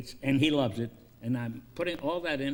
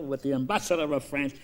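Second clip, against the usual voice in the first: normal talking voice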